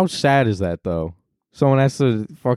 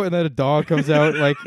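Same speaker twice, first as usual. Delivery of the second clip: usual speaking voice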